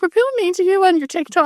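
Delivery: high, sing-song fake sympathy; mock concern. Teasing a co-host with mock sympathetic concern. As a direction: play up fake sweetness to tease the commenter. Mock quote of a caring commenter.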